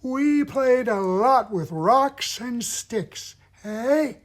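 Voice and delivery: put-on high, loud, airy old-timer, tight throat; old person. Shown as a demonstration. Old puppet recalling childhood to a kid, tight airy old voice. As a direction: hunch over and creak it out high. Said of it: "hunch over a little bit".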